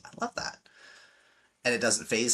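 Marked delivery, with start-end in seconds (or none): 0.0-0.6 whispered, unbothered; quiet laugh-off whisper; soft amused reply. Retold or imitated. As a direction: breathe it out, untouched by the jab. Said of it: "he just kinda laughs and goes".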